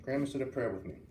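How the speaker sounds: usual speaking voice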